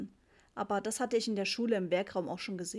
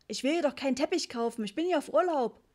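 high, exasperated protest; worked-up; 5 semitones higher, 4 dB louder than usual. A narrator's reading. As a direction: raise your voice to fend off the seller. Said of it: "rief Papa aufgebracht". (aufgebrachter, hoher Protest)

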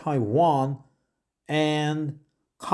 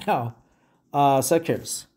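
ordinary talk

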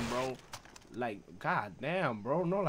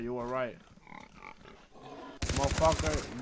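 ordinary talk, nothing marked

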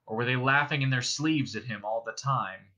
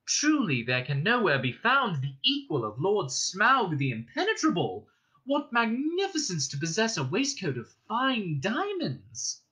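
higher, gushing flattery; flattering hobbit; 7 semitones higher than usual. Acted. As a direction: lay the praise on thick. Bilbo flatters the dragon.

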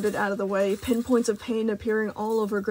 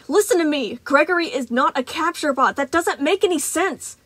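urgent plea; 6 semitones higher, 4 dB louder than usual. Acted. Freddy pleading mid-fight.

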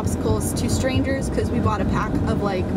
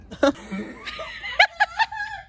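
high shrieking laugh; shrieking laughter. Real, not acted. Laughing hard at dad.

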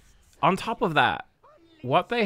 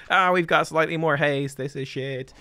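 disappointed; 4 semitones higher, 4 dB louder than usual. Pretend.

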